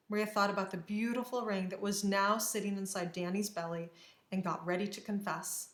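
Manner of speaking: ordinary delivery, nothing marked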